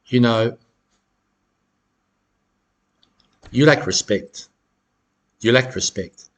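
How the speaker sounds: calm retort. Shown as a demonstration. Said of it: "You look right at them and you say".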